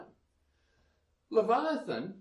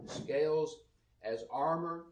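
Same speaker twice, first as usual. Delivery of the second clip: ordinary talk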